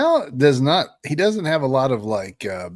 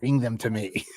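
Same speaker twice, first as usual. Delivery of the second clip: cold rasping evil emperor; 3 dB quieter than usual. Retold or imitated. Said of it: "my Liam impersonation".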